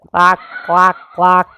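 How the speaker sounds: imitated duck quacking; duck quacks. A narrator's reading. Narrator voicing ducks on the water, imitated quacks.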